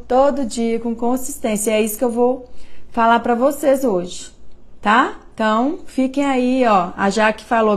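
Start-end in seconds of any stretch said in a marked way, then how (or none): none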